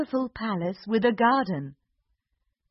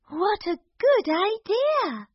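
high, slow princess voice; sweet delighted princess; cartoon princess; 11 semitones higher than usual. Princess voice in story.

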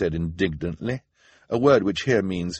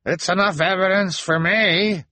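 high, loud, put-on elder voice; elder's cry; 13 semitones higher, 6 dB louder than usual. A narrator's reading. Elder answers the children's protest, indignant cry. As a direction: call out in condemnation. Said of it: "an elder cried".

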